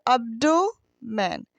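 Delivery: abdomen said slowly as ab-do-men, with do drawn out; split into syllables. Shown as a demonstration. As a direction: break abdomen into its beats, pausing before men. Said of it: "एक ये अलग है syllable". (abdomen को अब-डो-मेन में तोड़कर धीरे बोलें)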